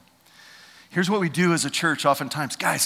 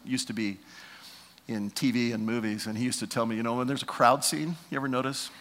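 ordinary delivery

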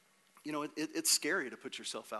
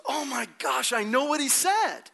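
loud, high-pitched amazed outburst; hammed-up shout of amazement; acted astonishment; 11 semitones higher, 9 dB louder than usual. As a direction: exclaim it at full volume, stunned with discovery. Acts out friend's astonished outburst.

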